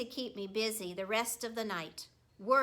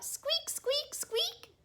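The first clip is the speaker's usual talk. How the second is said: very high little squeaks; squeaking hamster; much slower than usual. A narrator's reading. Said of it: "I thought".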